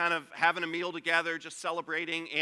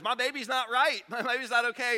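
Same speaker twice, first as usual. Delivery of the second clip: worried mom; 6 semitones higher, 3 dB louder than usual. Retold or imitated. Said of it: "She knows".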